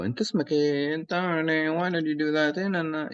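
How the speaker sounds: high rising question tone on "أنت اسمك إيه?"; high questioning Arabic tone. Shown as a demonstration. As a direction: lift the pitch up, asking. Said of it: "my tone is a bit high right now".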